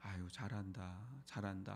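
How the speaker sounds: soft, patting praise of 잘한다; gentle encouragement. Retold or imitated. Elder softly praising a younger churchgoer, tender and quiet. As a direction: coo it softly like patting a back. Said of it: "토닥거려주고".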